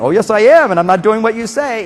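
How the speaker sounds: put-on loud, high kid voice talking back; defiant little boy. Boy to his mother, loud high defiant voice. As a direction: shout back like a stubborn child. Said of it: "you've got a power struggle".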